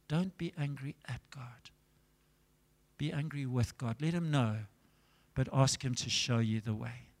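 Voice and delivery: hushed, near-whispered fatherly counsel; low, quiet, breath-light advice; weakened voice. Retold or imitated. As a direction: counsel very softly, as if the voice has gone. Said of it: "and God took his voice".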